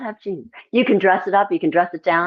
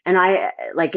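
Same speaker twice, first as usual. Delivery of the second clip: ordinary talk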